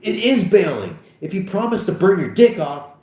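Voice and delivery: high, insistent quarrel; worked-up bickering; heated arguing. Acted. Acted quarrel between two friends.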